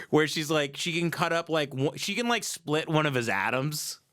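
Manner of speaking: usual speaking voice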